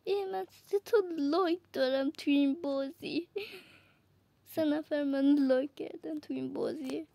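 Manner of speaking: ordinary talk, nothing marked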